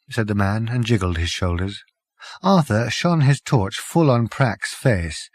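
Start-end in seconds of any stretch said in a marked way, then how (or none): none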